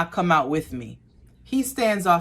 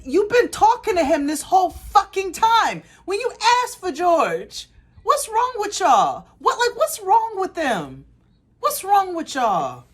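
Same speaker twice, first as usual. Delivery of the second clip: much higher, louder incredulous rant; exasperated disbelief; 4 dB louder than usual. Retold or imitated. Narrator scolds the customer for his ignorance, exasperated and loud.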